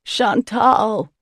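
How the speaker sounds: yelling, voice breaking. Acted. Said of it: "Jonah yells again, his voice breaking".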